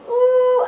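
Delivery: high, loud "ooh"; sudden ooh. Real, not acted. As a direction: yelp an ooh as you hit the sore spot. Rolls onto a tender spot.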